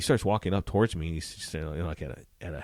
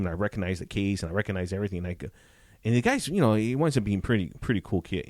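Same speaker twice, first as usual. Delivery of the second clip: ordinary delivery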